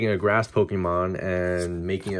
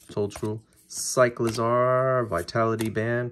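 ordinary talk, nothing marked

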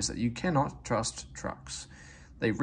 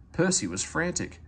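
ordinary delivery, nothing marked

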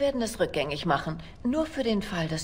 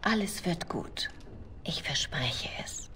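soft whisper; 5 dB quieter, slower than usual. Acted. Soothes her partner, promise.

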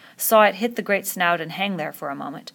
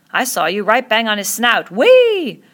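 loud, ending in a soaring whoop; excited cheer; 4 semitones higher, 9 dB louder, slower than usual. Acted. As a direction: cheer the hit, whooping high. Admiring witness cheers the hit.

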